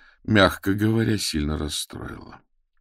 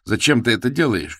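higher, louder cry at her; desperate shout; 7 semitones higher, 6 dB louder than usual. A narrator's reading. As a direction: yell the question at Agatya. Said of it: "крикнул я ей".

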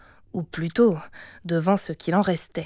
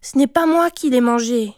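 put-on raised, louder child's denial; protesting; 5 semitones higher, 5 dB louder than usual. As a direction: plead your innocence. Child denies eating the cake. (protestation d'enfant)

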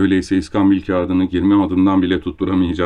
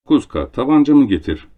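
loud call, a touch higher; calling to the servant; 5 semitones higher, 5 dB louder than usual. A narrator's reading. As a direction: bark the order across the room. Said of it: "diye bağırırdı uşağına".

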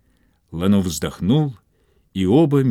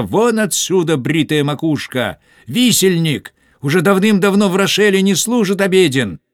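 high, loud soldier's yell; shouting soldier; 9 semitones higher, 5 dB louder than usual. A narrator's reading. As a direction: bellow at the monk to clear out. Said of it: "крича". (громкий высокий солдатский крик)

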